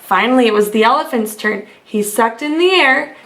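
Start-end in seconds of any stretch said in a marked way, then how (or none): none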